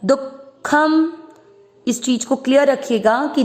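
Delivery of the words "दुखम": दुखम very slow and higher, a stop after दु; stressed du. Shown as a demonstration. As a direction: pause sharply after दु in दुखम. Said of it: "दु के बाद में आघात देना पड़ता". (दुखम, दु के बाद आघात)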